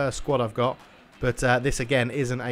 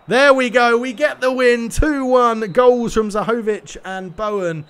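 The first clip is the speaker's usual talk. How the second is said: much higher, louder victorious call; triumphant celebration; 9 semitones higher, 8 dB louder, slower than usual. Real, not acted. Player celebrating his two one win, triumphant. Final whistle, he wins.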